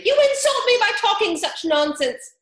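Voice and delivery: high, loud indignant squeak; offended mouse. A narrator's reading. Mouse storming off from Alice, indignant squeak. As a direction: huff it out as an insulted mouse.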